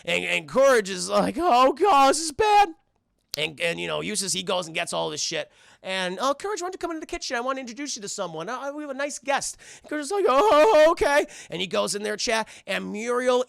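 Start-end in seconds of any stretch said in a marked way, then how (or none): 1.4-2.7 high, loud cartoon panic; mock dread. Dog foreseeing Eustace's hunt, mock dread. Courage dreads what comes. 6.2-9.6 high, quick old-lady cartoon voice; sweet Muriel impression. Muriel calling dog to meet guest, sweetly. 10.3-11.2 high, loud cartoon unease; nervous agreement. Dog agreeing to meet guest, nervously.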